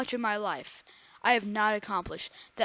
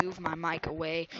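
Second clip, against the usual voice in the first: ordinary talk, nothing marked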